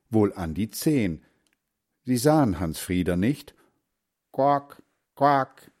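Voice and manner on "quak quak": higher-pitched calling quack; mother duck quacking. A narrator's reading. Mother duck calls her children, quacking. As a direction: quack out to the brood. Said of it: "rief die Mutter".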